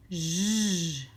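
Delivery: J as a long, low buzzing consonant; isolated J sound. Shown as a demonstration. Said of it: "ça ferait".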